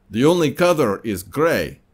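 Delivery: put-on bored salesman in a Russian accent. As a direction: drone it out like a Soviet clerk, Russian accent. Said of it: "if you didn't like my Russian accent".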